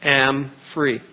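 I am free, each word slow and firm; slow, resolute declaration of freedom. Shown as a demonstration. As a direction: plant each word like a vow. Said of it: "tell me the truth".

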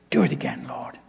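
hushed, whispered "Do it again"; whispered plea. Acted. Preacher praying for revival, whispered. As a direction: breathe it like a private prayer.

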